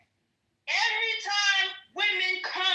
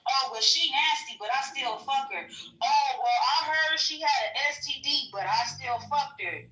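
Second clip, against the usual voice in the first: lower, lightly voiced lazy dude shrugging it off; mock dumb man; 5 semitones lower than usual. Mocking men who sleep with women they call nasty, lower and lazy. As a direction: mumble it like a guy who sees no problem. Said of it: "I've heard too many men that already said that".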